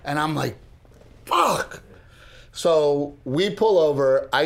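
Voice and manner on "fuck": mock dismayed curse. Driver reacting to hitting the deer, high mock yelp. His reaction to the crash retold.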